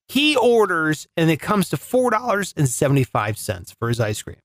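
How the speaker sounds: usual speaking voice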